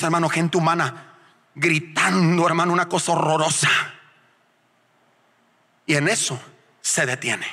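ordinary talk, nothing marked

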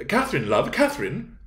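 high, pleading appeal to a sweetheart; shocked interjection. Acted. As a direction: step in, shocked, to check her. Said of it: "greatly shocked".

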